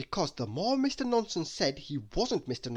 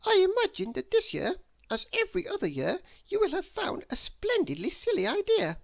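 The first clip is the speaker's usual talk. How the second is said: put-on little girl asking. Miss Brainy continues.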